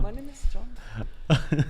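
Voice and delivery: slow, put-on mock accent; lightly voiced slow mock accent. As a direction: lightly stretch out a silly voice.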